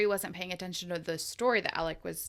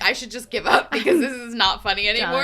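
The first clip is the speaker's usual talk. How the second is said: loud, higher, half-laughing complaint; mock exasperation; 4 semitones higher, 9 dB louder than usual. Ignored storyteller on the third try, mock exasperation. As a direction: throw it out loudly, giving up.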